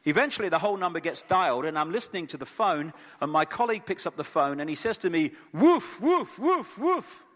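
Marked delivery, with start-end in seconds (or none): 5.5-7.2 high, louder mock woofs like a golden retriever; barking like a dog. Colleague on the phone sounds like a dog, high mock barking. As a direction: bark it out like a happy retriever. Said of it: "he sounds like a golden retriever".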